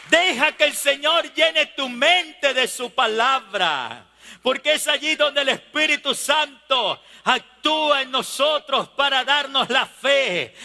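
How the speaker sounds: driving, insistent exhortation; forceful preaching. Real, not acted. Preacher urging believers to renew their faith, forceful preaching. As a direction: preach it with rhythmic, mounting emphasis.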